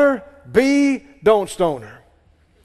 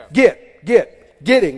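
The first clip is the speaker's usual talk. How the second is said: "Get, get, getting" with each "get" punched loud; emphasis on get; 5 dB louder, slower than usual. Shown as a demonstration. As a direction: hammer every "get". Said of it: "play and emphasis on the words".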